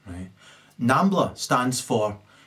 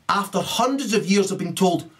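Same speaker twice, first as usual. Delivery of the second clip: ordinary talk, nothing marked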